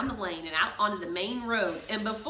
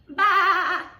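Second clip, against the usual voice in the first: loud higher bah with a laugh; laughing higher bleat; sheep bleat; 9 semitones higher, 9 dB louder than usual. Acted. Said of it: "said Sheep".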